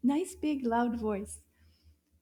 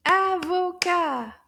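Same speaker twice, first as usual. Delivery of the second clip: Avocat loud, higher, in three separate beats; syllable by syllable; 5 semitones higher, 8 dB louder, slower than usual. Shown as a demonstration. Teacher trying the syllable count again, Avocat in loud beats. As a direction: clap out Avocat strongly, slowly. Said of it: "Let's try that again".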